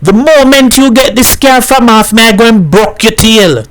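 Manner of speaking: high, insistent angry threat; shouted threat. A narrator's reading. Baku threatens Hat from under the car, shouting. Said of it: "Baku shouted from under the car".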